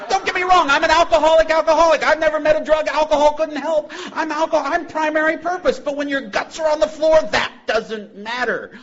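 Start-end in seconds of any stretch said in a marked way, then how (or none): 0.1-3.9 booming high, loud announcement; proud loud declaration. Retold or imitated. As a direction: shout it out proudly, like a rally cry. Loudly owning his alcoholism.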